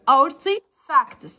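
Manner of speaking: artifacts stretched out very slowly; slow model word. Shown as a demonstration. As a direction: spell out artifacts sound by sound. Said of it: "teacher models new word slowly".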